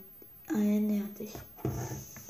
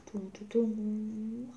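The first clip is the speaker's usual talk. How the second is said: sound effect; 5 dB quieter, much slower than usual. Pretend. Sound effect entering volcano.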